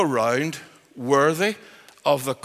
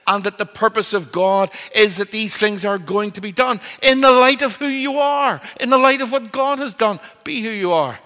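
raised, ringing preacher's delivery; impassioned preaching; 5 semitones higher, 5 dB louder than usual. Real, not acted. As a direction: preach it with rising force, then land firmly. Preaches at full force.

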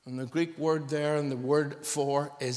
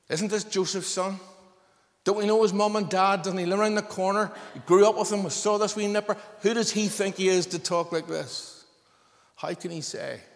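higher and louder indignant questions; scoffing crowd; 6 semitones higher, 6 dB louder than usual. Retold or imitated. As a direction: sneer like neighbours who knew him as a boy.

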